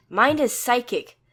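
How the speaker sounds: high, loud and sharp; sharply. A narrator's reading. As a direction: snap it out urgently. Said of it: "Dis says sharply".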